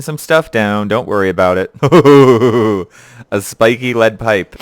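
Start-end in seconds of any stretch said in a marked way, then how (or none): 1.8-2.8 loud gleeful hooting chuckle; loud, delighted laugh. Real, not acted. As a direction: hoot with glee at a find. Delighted by a weapon found.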